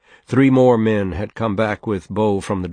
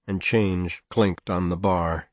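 ordinary delivery

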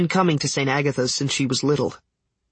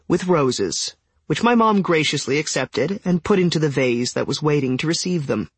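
ordinary delivery

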